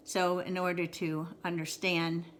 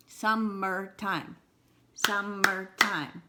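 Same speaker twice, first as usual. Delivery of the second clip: "Summertime" said slowly, sum-mer-time, one beat at a time; slowed to syllables; slower than usual. Shown as a demonstration. Teacher stretches the word so kids count beats, slowly. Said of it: "sometimes we do slow it down".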